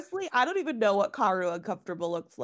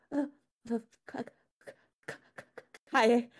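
through gritted teeth; 4 semitones higher than usual. Acted. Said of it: "Through her teeth and still".

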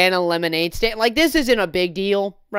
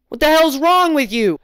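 higher, louder indignant jab; mock angry scold; 7 semitones higher, 6 dB louder than usual. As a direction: tell him off hotly. Customer scolding, acted.

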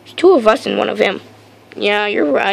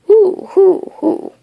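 owl hooting; 9 semitones higher, slower than usual. Acted. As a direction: hoot like an owl. Owl calls.

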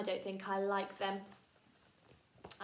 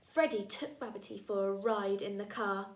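usual speaking voice

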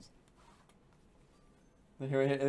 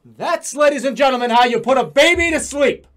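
loud, higher victory announcement; triumphant showman; 5 semitones higher, 9 dB louder than usual. Pretend. Player announcing how you put a baby to sleep, triumphant. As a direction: proclaim it like a proud showman.

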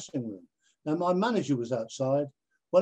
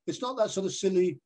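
usual speaking voice

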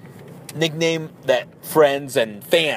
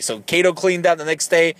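usual speaking voice

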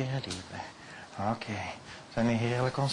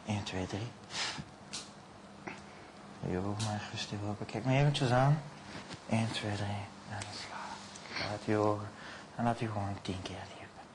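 hypnotic induction; 3 semitones lower, 5 dB quieter than usual. Acted. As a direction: lull the subject down into trance. Hypnotist inducing trance on stage.

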